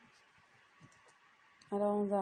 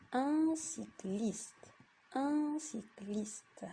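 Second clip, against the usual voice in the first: very slow, syllable by syllable; slow reading; 3 semitones higher, much slower than usual. Shown as a demonstration. Teacher reads slowly so pupils can follow. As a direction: spell out un cycliste slowly. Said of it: "reading demo for pupils".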